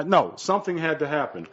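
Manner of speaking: usual speaking voice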